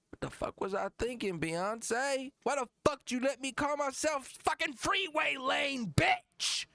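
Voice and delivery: high, outraged mock rant; hammed-up angry rapper impression. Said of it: "Then he gets mad at [name]".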